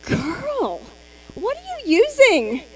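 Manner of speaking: put-on high, eager "girl" voice that lifts on the question; excited stranger. A total stranger stopping the consultant, excited and curious. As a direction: play a delighted stranger who must know your secret. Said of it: "strangers are gonna come up to you and be like".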